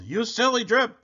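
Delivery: high, loud mocking yell, then slowing; storybook jeering yell. A narrator's reading. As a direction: yell it at poor Drip in a high voice. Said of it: "but the others yelled".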